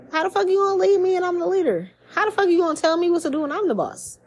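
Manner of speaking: high-pitched mock indignation; indignant challenge. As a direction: act out an affronted boss objecting. Acting out defiant would-be leaders objecting.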